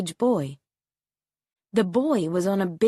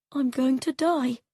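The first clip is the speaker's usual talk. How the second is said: put-on higher, a touch of fear in a boy's voice; frightened thought; 6 semitones higher than usual. A narrator's reading. Boy afraid in the helicopter, fearful.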